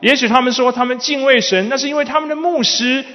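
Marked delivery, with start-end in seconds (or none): none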